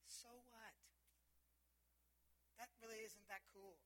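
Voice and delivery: soft, underwhelmed murmur; unimpressed listener. Acted. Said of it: "Some of you were like".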